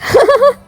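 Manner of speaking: high, playful giggle; teasing giggle. Acted. Girl fools her worried friend, teasing giggle. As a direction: giggle at a successful prank. Prank revealed after playing dead.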